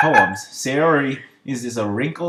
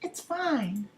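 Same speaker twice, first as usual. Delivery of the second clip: put-on high girl voice; 3 dB quieter, much slower than usual. Voicing Yuri.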